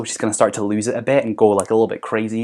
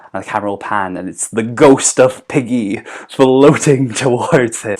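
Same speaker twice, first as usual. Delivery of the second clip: usual speaking voice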